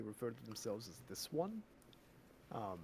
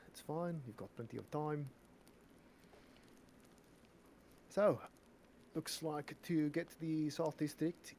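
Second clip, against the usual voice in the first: put-on Afrikaans accent. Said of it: "My tongue is tired from doing an Afrikaans accent".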